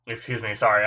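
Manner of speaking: ordinary talk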